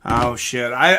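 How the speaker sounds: ordinary talk, nothing marked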